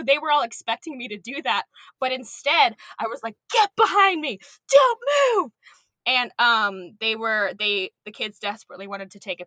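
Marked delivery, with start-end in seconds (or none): 3.5-5.5 high, loud alarmed shout; panicked command. Retold or imitated. Camp teacher ordering kids away from a snake, panicked shout. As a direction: shield the kids with urgent high-pitched orders.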